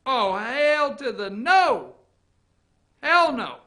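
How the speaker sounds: loud, punchy HELL no; emphatic hell no. Shown as a demonstration. Host repeating his refusal, loud and punchy. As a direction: slam the first word Hell. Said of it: "I'll say that three times".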